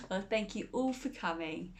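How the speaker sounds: ordinary delivery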